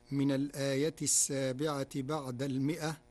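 ordinary talk

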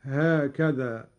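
ordinary talk, nothing marked

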